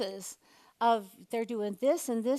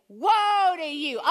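slow, high, louder Woe to you; angry woe; 6 semitones higher, 6 dB louder, much slower than usual. Shown as a demonstration. Preacher showing the congregation how Jesus says it angrily. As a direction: thrust the finger out and boom Woe. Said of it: "Jesus is angry. Gotta do a little better".

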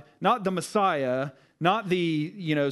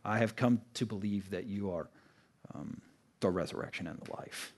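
hushed awed reply; 6 semitones lower, 9 dB quieter than usual. Acted. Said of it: "she exclaims".

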